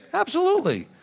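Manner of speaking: high-pitched imitation of an assured pastor; mock certainty. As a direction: answer high and emphatic, mimicking him. Acting out pastor's confident answer.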